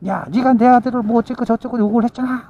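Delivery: high, sharp accusation; accusing shout. Retold or imitated. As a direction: throw the blame at her.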